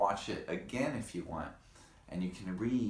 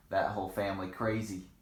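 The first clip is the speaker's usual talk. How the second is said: usual speaking voice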